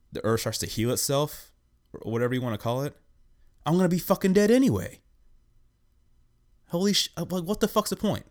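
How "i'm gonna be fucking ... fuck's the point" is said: exasperated outburst. Real, not acted. Ranting exasperated about the future.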